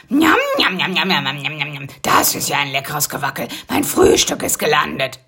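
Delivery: lazy, slurred munching noises; half-asleep mumbling. Acted. Half-asleep spider greeting his breakfast, mumbled. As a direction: mumble it while still waking up. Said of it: "nuschelte er fast noch im Halbschlaf".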